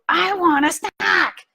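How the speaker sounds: put-on high, slow whining child; whiny kid demand. Son repeating his demand to mom, high, slow whine. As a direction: drag out the demand like a sulky kid. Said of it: "And he'd go".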